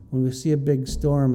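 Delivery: ordinary talk, nothing marked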